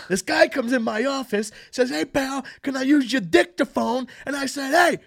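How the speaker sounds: high, loud, slow joke-telling comic voice; hacky Catskills comic. Retold or imitated. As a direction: punch the joke like a Catskills hack. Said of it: "doing this character that's, like, a hacky Catskills comic".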